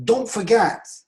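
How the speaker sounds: loud, high-pitched reminder; exclamation. Shown as a demonstration. Teacher models ending with a reminder, exclaimed. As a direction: cry out the reminder. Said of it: "Böyle deyip".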